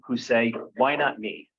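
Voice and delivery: normal talking voice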